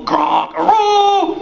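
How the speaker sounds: loud honking bellow on aroo; moose honk. Acted. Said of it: "cannot make no quack sound".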